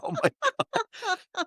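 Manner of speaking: tiny, very high squeak; high-pitched mock astonishment; stunned disbelief. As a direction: squeak it slowly, softly in amazement. Amazed his fake accent worked.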